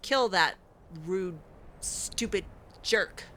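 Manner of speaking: normal talking voice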